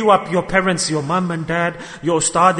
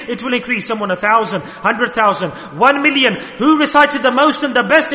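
ordinary talk, nothing marked

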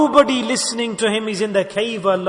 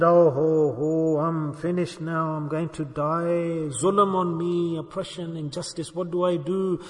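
low, slow, weakened groaning lament; drawn-out, fading words of imitated despair; dying man's lament; 4 semitones lower, 7 dB quieter, slower than usual. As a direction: mimic a dying man bemoaning his fate, softly. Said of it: "he started to speak".

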